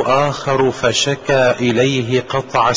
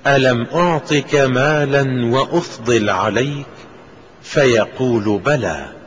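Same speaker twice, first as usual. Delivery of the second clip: normal talking voice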